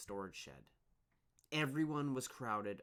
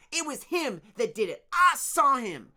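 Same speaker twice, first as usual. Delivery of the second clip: shrieking; 16 dB louder than usual. Acted. Torin shrieking an accusation at Dune, very high. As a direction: shriek it, pointing. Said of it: "he shrieked".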